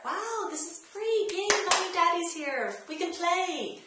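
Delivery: put-on high, delighted little kid finding a parent outside the door; imitating an excited child. Toddler thrilled to find mom at the door, high and gleeful.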